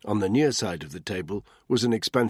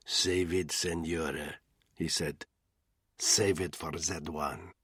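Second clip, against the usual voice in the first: menacing thug; 2 semitones lower, 5 dB quieter, slower than usual. A narrator's reading. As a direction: brush her off quietly and coldly. Thug answers Anna.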